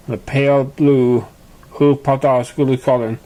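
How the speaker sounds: ordinary delivery, nothing marked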